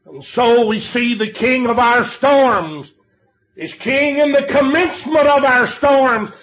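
swooping, punched emphasis on each repeated phrase; full-force preaching. Real, not acted. Preacher proclaiming the King of storms to the congregation, full-force preaching. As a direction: build in waves, stretching the key words.

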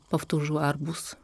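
ordinary delivery, nothing marked

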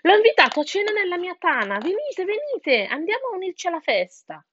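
high, eager, sing-song invitation; coaxing fox. A narrator's reading. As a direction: lure them in with false cheer. Fox coaxes birds into den. (volpe invitante, voce alta e allegra)

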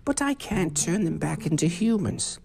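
put-on high, quavering old witch; old witch telling the boys about her spell; old lady voice. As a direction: play the kind old witch explaining, apologetic.

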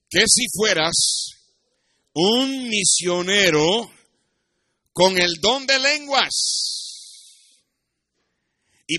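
loud, slow, rising sermon climax; preaching loud. Real, not acted. (predicando fuerte y despacio)

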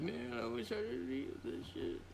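put-on high, quavering old-timer complaining; old man voice. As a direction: play a creaky old man regretting his diet. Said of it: "I'll be like".

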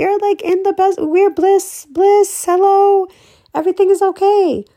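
high-pitched, blissed-out exclaiming; acted bliss. Describing bliss with friends, high and elated.

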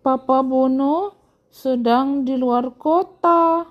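put-on high, slow girly character voice; girlish voice. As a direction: draw it out in a high girlish storybook voice. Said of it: "jadi kayak cewek suaranya".

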